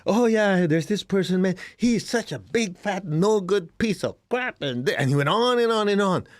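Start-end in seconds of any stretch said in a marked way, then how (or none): 0.0-4.6 much higher, slower indignant gossip voice; exaggerated complainer venting; mock griping rant. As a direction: ham up a whiny badmouther. Mocking someone badmouthing a person.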